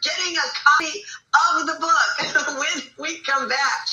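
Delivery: high, laughing giveaway tease. Real, not acted. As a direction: tease the audience through a laugh. Host teasing giveaway, laughing.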